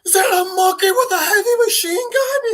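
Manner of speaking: high-pitched incredulous squeal; over-the-top astonished outburst; excited amazement. Retold or imitated. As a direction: cry it out in giddy disbelief. Same screaming character, still amazed.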